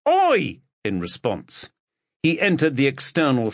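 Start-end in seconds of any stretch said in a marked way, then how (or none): 0.1-0.6 a sharp, much higher and louder yelp of Oi!; high-pitched oi. A narrator's reading. Kids yell at the detective pushing past, high-pitched shout. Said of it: "getting a high-pitched".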